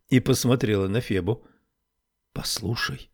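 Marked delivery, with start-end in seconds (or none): 2.4-3.1 softly, in a hushed near-whisper; приглушённый шёпот. A narrator's reading. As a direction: drop the volume right down to a confiding hush. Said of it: "произнесла она шёпотом". (тихо, почти шёпотом)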